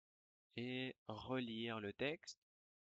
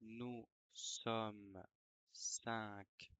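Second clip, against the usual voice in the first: dictating. Shown as a demonstration. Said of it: "je vais dicter une dictée".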